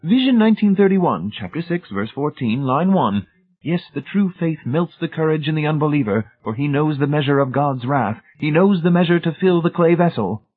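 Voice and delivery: high, loud, brisk scripture reading; rapid efficient reading. A narrator's reading. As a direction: rattle off the verse efficiently. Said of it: "In a rapid, efficient voice he read".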